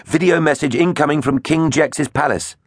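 high-pitched urgent report; urgent call. A narrator's reading.

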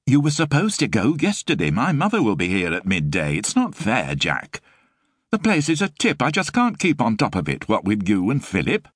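put-on a harried young mum scolding her lodger; exasperated Sue. A narrator's reading. Narrator voices Sue, exasperated.

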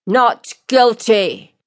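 slow, high proclamation with a falling end; loud and clear. Acted. Guard announcing the plea to the court, loud and clear. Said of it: "The guard speaks loud and clear".

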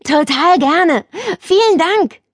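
put-on high, slow, keen boy's thanks; a boy happily accepting cocoa; eager boy. A narrator's reading. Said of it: "Lukas nickte eifrig".

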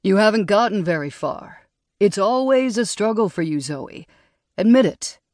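lightly voiced smug rival; taunting colleague. A narrator's reading. Drew needles her.

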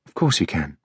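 soft, tender reassurance; gentle. A narrator's reading. Sophie gently reassuring Elliot, soft and tender. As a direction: softly comfort the worried boy. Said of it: "she said gently".

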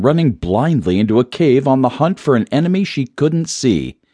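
plain reading voice

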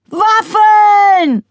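high, loud shriek; shrieked demand. A narrator's reading. Wife shrieking for waffles from the window. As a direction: screech it from the window. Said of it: "kreischte die Stimme vom Fenster her".

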